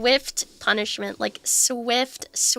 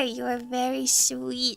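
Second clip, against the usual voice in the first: normal talking voice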